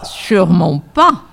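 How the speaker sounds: emphatic cut-off; cutting in. A narrator's reading. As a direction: cut the other speaker short. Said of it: "en coupant court à la voix".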